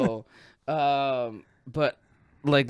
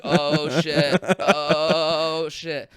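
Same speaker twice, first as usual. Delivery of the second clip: long drawn-out "oh"s; mock dread; much slower than usual. Rider holding on through the spin, drawn-out mock dread. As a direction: groan it while hanging on. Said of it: "I'm just holding on".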